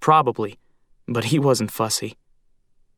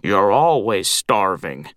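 put-on gruff old crow; 5 semitones lower than usual. A narrator's reading. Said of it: "said Glum".

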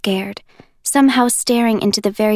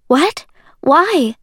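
high, louder, startled questions; shocked outburst; 7 semitones higher, 4 dB louder than usual. Acted. Juliet reacting to being hidden, startled and loud. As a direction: burst out in disbelief.

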